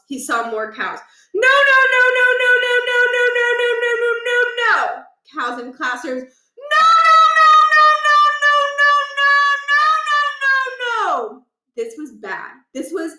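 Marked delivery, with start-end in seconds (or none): 1.3-5.0 much higher, loud frantic no's; desperate denial; full panic. Acted. Scene: principal running through cow-filled halls, frantic. Runs past more cows. 6.2-11.5 very high shouted no's breaking to a wail; screaming in despair; panicked shouting. Acted. Scene: principal finds cows in classrooms, screaming despair.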